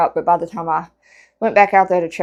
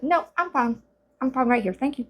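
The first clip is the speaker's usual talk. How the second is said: higher, softer little cat voice; voiced cat; 6 semitones higher, 5 dB quieter than usual. Stray kitty declining to go out, small polite cat voice. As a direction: politely refuse in a small high voice. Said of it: "She's like".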